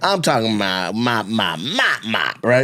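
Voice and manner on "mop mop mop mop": "mop" chanted low in an even beat; every "mop" bounced in the same pattern; repeated cadence. Retold or imitated. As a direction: repeat "mop" like a rhythmic sound effect. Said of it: "The cadence".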